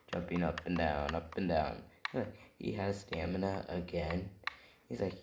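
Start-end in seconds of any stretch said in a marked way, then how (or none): none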